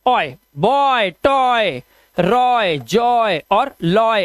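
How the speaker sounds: "बॉय, टॉय, रॉय, जॉय" slowly, with a full oy glide; pronunciation demo. Shown as a demonstration. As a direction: stretch the "ऑय" in each model word. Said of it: "ऑय का उच्चारण करना पड़ेगा".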